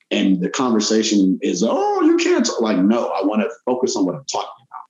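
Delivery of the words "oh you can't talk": squeaky mocking falsetto; mock them in a high squeak. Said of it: "mocking people's reaction".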